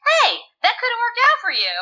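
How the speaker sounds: high-pitched mock encouragement; retold reaction. Fan addressing the villains, high mock encouragement. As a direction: pitch it way up, sing-song.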